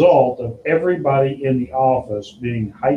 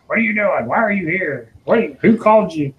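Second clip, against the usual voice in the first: raised-pitch grilling of a stranger; suspicious questioning; 7 semitones higher than usual. Retold or imitated. Staff grilling the fax repairman, mock suspicious. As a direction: interrogate him like a nervous guard. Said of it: "everybody that walked past him questioning".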